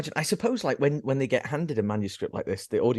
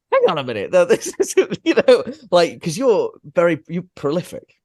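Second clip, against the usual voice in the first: mock indignant protest; 9 semitones higher, 9 dB louder than usual. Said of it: "And I'm like".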